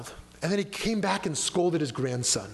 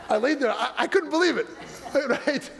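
higher, laughter running through the words; laughing while talking; 7 semitones higher than usual. Real, not acted. Rabbi telling congregation about being ignored, laughing. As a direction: talk through real laughter.

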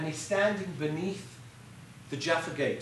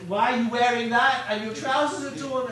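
fussy grandparent scolding, raised; 5 semitones higher, 7 dB louder than usual. Retold or imitated. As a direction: nag like a doting grandmother. Old volunteers scolding students' clothes.

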